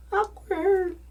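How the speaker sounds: very high singsong mock voice; awkward aside. His reaction to the awkward moment.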